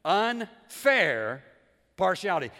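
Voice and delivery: "Unfair" stretched loud and rising, then a pause before "partiality"; emphatic repeat. Shown as a demonstration. Preacher repeats favor's definition so it sinks in, emphatically. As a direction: punch "Unfair", hold it, then land "partiality" loudly. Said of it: "let me say it again".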